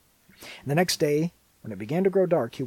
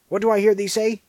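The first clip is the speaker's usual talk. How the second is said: much higher and louder, shrieking in outrage; furious cry; 11 semitones higher, 13 dB louder, faster than usual. Acted. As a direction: hurl it at the child. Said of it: "cried the enchantress".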